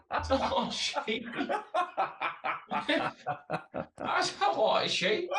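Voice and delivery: high-pitched, laughing through the words. Real, not acted. Mocking a mate's claim, squealing with laughter.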